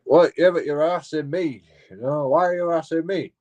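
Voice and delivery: imitating a driver who feels harassed; mock indignant complaint. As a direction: whine it like the accused driver.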